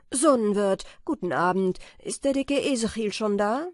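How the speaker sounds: laut und vornehm gerufen; lauter Gruß. Acted. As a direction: ruft großspurig in die Wirtsstube. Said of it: "stieg vom Wagen und schrie". (Peter ruft vom Wagen laut dem Wirt zu)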